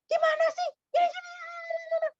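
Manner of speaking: high, sharp, impatient nagging; high-pitched nagging. Shown as a demonstration. Showing how a high nagging tone sounds. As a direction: pitch it way up and complain. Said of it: "nada suaranya tinggi terus".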